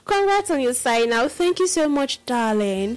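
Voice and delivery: ordinary talk, nothing marked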